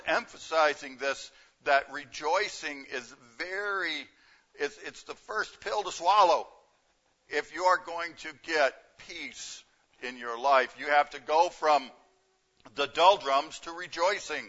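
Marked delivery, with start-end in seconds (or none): none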